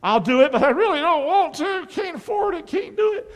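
high, whiny reluctant giver; mock whiny grudging churchgoer. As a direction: whine it like you hate giving. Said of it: "that's a grudging giver".

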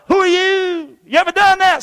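high-pitched mock challenge; questioning, challenging. Moses questioning God, high challenge. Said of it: "he starts questioning God".

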